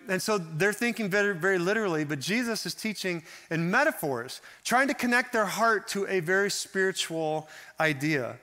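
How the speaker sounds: ordinary delivery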